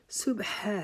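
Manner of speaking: صبح ہے with ح breathed out one alif long; breathy ha held long. Shown as a demonstration. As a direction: let the ح hiss out slowly. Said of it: "ہا کو آپ نے ایک الف کے جتنا لمبا کرنا ہے". (ح کو سانس کے ساتھ لمبا کرنا)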